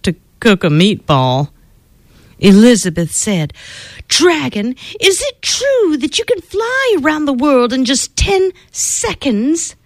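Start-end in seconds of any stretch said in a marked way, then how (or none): 4.1-9.8 high, sweet-talking princess voice; girl buttering up a vain dragon; flattering princess. Acted. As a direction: coax the dragon with wide-eyed praise.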